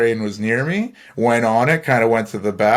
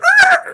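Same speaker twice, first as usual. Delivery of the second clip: high, loud vocal record scratch; imitated record scratch; 7 dB louder than usual. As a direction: mimic a record screeching to a stop. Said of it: "record scratch and everybody stops".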